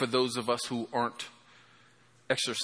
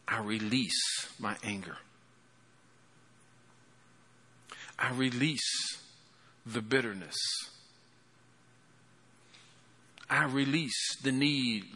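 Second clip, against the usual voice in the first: soft, low, hushed prayer voice; tender altar-call prayer, low and soft; leading a prayer; 4 semitones lower, 7 dB quieter than usual. Acted. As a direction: pray gently over the congregation, quieter and quieter. Pastor leads the closing prayer.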